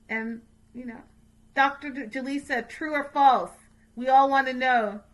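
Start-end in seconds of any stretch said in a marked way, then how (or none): 1.5-5.0 loud call to a friend. Real, not acted. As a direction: call her over loudly, asking. Calling out to Jaleesa.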